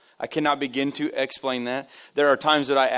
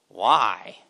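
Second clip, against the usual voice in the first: low, curt-sounding suspicious why; imitated unfriendly lady; unfriendly stranger; 6 semitones lower than usual. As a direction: question it like a grumpy stranger. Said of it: "She's friendly, real, real friendly".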